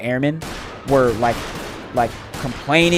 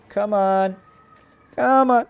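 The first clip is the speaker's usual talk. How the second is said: higher, rising "come on" egging him on; inviting the fight; 8 semitones higher, slower than usual. Retold or imitated. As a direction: beckon the challenger in. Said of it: "I'm like".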